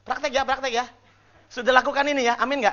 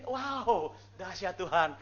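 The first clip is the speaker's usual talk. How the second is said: awed response; 2 semitones lower, 7 dB quieter, much slower than usual. Shown as a demonstration. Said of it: "ngajarin kayak gini".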